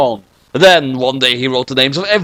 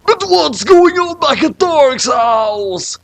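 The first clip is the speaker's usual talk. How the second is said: slow dramatic high; 12 semitones higher, slower than usual. Acted. Dramatic cliffhanger line.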